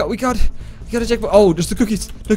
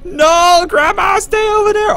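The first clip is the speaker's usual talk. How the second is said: panicked shout; 5 semitones higher than usual. Real, not acted. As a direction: yell her back in real fright. Grandma closing in.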